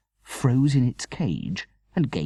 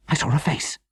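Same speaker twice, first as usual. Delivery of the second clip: frightened whisper; 8 semitones higher than usual. A narrator's reading. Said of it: "Mother whispered".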